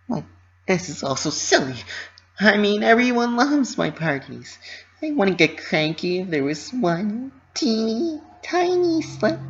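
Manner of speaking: high, forced cheerful reassurance; self-reassuring Pinkie. Acted. Pinky convincing herself everyone loves her parties, high cheerful voice. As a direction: talk yourself up, high and bright.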